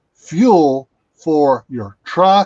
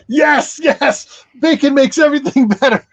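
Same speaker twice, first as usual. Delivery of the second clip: laughing; 9 semitones higher, 4 dB louder than usual. Real, not acted. Laughing through the bacon joke.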